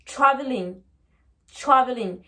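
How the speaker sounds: TRAVELING as "chraveling", very faint R. Shown as a demonstration. Teacher illustrating the faint R, TRAVELING with ch. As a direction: start TRAVELING on ch, weak R. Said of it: "mais le son est très faible".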